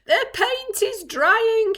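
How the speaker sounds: high, slow drawn-out moan. Acted. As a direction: moan it pitifully. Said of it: "he moaned".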